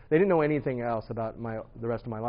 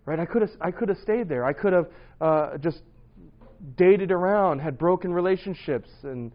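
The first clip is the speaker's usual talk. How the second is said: louder, higher, earnest preacher's push; rising preaching; 5 semitones higher, 7 dB louder than usual. Acted. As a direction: drive the lesson home to the congregation. Preaching climax of testimony.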